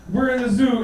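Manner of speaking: ordinary delivery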